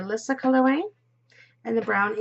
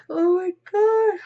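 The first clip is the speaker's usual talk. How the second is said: words stretched through a real yawn; 8 semitones higher, 6 dB louder than usual. Real, not acted. Vlogger to viewers, caught mid-yawn, yawning. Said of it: "I start talking and I'm yawning".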